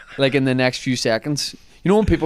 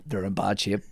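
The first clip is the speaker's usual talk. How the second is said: hushed, breathy whisper; 4 semitones lower, 9 dB quieter than usual. Retold or imitated. Calvin whispering the lads are in bad shape. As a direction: lean in and whisper the bad news. Whispered warning about drunk mates.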